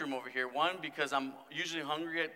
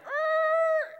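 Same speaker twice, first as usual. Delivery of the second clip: screeching halt noise; 6 dB louder than usual. Retold or imitated. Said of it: "movies when everything ... screeching halt".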